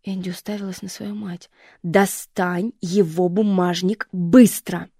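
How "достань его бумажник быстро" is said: громкий медленный приказ; прикажи громче, чеканя; жёсткий приказ. Acted. Мать приказывает. (мать громко и медленно приказывает дочери)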